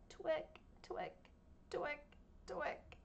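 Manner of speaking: short scratchy twick sounds, slowly; toenail scratch sounds. Shown as a demonstration. To the class, toenails scratching: twick. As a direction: click out each twick. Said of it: "his toenails scratch the sidewalk".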